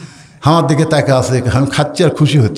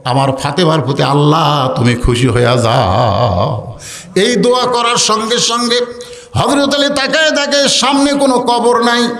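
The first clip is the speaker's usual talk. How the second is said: melodic waz preaching; 8 semitones higher than usual. A narrator's reading. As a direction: intone high, stretch the closing words. Waz preacher chants Ali's lament.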